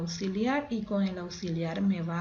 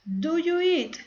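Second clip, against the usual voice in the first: loud model; 9 semitones higher, 7 dB louder, slower than usual. Shown as a demonstration. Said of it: "yo puedo responder".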